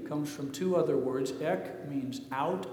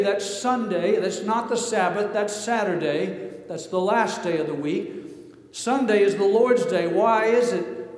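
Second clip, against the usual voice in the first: louder, driving preacher's cadence; building sermon climax, louder; preaching at full force; 3 semitones higher, 6 dB louder than usual. Acted. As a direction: preach it to the room with fire.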